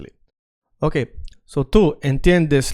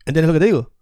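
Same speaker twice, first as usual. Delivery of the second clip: quick native-speed Spanish; natural fast speed; much faster than usual. Shown as a demonstration. Teacher to students, showing fast native Spanish. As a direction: rattle it off like a native would. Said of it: "I'm saying it very fast".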